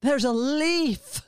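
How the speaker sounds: put-on slow, marveling little kid voice; a toddler pointing out a leaf in awe; hushed, amazed child voice, drawn out; toddler wonder.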